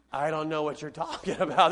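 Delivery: low, soft mock-puzzled kid with a laugh; clueless eight-year-old. Eight-year-old misses mom's lesson, mock cluelessness. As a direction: play a baffled child, low and chuckling. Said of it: "when they're eight years old, they're like".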